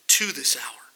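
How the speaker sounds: hushed whisper, dropping low; a whispered surrender after the anguished outcry; whispered resolve. Acted. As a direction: breathe the last words in a whisper. Jesus resolving, quiet after cry.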